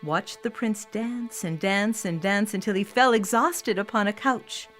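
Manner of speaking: ordinary delivery, nothing marked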